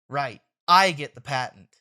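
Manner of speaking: ordinary talk, nothing marked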